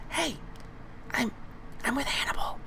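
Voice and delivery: tiny, high, whispery voice; high-pitched creature. Acted. Tiny creature at the player's neck, high whisper. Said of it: "very high-pitched".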